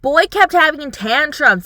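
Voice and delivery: usual speaking voice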